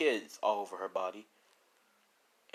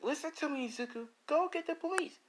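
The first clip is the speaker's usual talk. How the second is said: much higher, firm woman's voice; insistent woman. Acted. Woman insists Izuku go get police, high and firm. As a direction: order him to go now.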